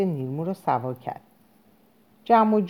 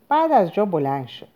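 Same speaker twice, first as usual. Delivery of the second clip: usual speaking voice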